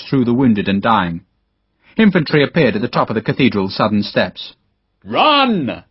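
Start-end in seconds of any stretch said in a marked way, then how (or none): 5.0-5.8 urgent higher yell; shouted order. Acted. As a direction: send the men running from the charge. Officer ordering retreat under attack.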